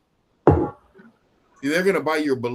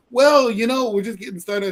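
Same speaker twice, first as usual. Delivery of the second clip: higher, louder opening, dropping off; evasive prospect; 7 semitones higher, 4 dB louder than usual. Retold or imitated. Prospect answering whether his businesses make money, evasive. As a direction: lightly voice a defensive prospect.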